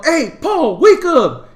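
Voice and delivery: high, loud retold shout; calling out to wake. Teller replaying waking his drunk friend, shouting. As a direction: holler at a sleeper to rouse him.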